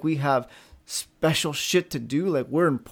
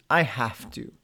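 heavy have to; 7 semitones lower, 5 dB quieter, slower than usual. Shown as a demonstration. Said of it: "Observe what changes".

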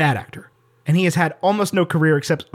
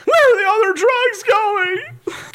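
much higher, slow squeaky bat Batman; high-pitched bat voice; slower than usual. Retold or imitated. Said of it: "like, high pitch, like hypersonic, like a bat".

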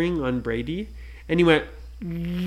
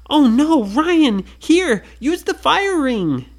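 higher, louder urgent shout; urgent call; 11 semitones higher, 8 dB louder than usual. Acted. Nora throwing Ryan the fire ring, urgent shout. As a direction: hurry to help him.

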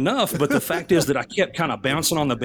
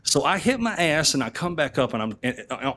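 usual speaking voice

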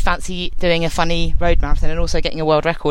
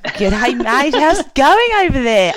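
put-on Aussie accent; 8 semitones higher, 5 dB louder, slower than usual. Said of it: "I do a really great Aussie accent".

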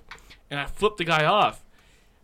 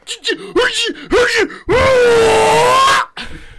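sustained high, loud rage noise; loud strangled gasp-yell; 9 dB louder than usual. Retold or imitated. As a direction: choke out a furious wordless cry. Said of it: "I was like".

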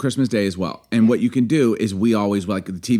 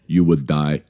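low movie-villain Russian accent; best Russian accent; 6 semitones lower, slower than usual. Shown as a demonstration. Host channelling Rocky Four, menacing Russian accent. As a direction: pronounce the threat low, in heavy Russian accent. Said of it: "here's my best Russian accent".

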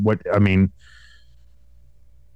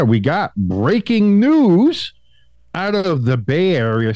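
news anchor; 7 semitones higher, slower than usual. Acted. Announcing news mock-dramatically.

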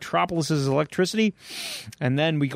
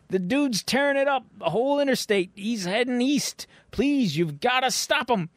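pleading trucker; 7 semitones higher than usual. Acted. Trucker begging troopers on the radio, high pleading voice. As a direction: beg the troopers to stop him.

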